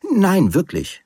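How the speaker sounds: high-pitched, emphatic denial; earnest protest. Acted. Man assuring his companion after her "Pfui", protesting.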